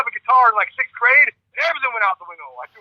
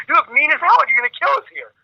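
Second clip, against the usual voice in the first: imitating the employee; 6 semitones higher, 3 dB louder than usual. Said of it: "He goes".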